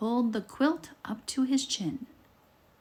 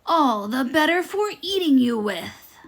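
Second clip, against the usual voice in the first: menacing wolf; 5 semitones higher, 7 dB louder than usual. Acted. Wolf reveals himself.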